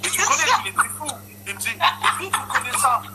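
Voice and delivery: voix très aiguë, pouffant de rire; en riant. Real, not acted. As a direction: raconte la chute en éclatant de rire. Raconte une anecdote en riant. (rit en racontant la réaction du cheikh)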